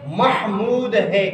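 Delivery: محمود as "MAH-mood"; correct pronunciation. Shown as a demonstration. Teacher giving class the right name, correct pronunciation. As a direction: open "a", clear h in محمود. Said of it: "میرا نام کیا ہے".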